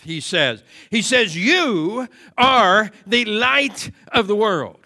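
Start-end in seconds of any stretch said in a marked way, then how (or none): 1.4-4.8 "You" drawn out, the rest slowly spaced; slow preaching with "You" held long; stress on YOU. Real, not acted. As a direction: lean hard on "You", then measure out each word. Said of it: "The emphatic word is you".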